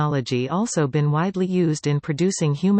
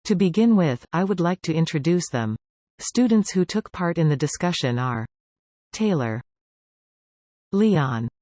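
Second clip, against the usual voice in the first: Robot [name] voice. Acted. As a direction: toy robot voice. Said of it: "I am Robot [name]".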